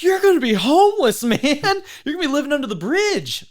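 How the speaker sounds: very high, loud hammed-up alarm; mock freak-out. Shipmates hearing about Bible college, mock horrified wail. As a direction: exaggerate friends predicting total disaster. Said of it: "like, freaking out".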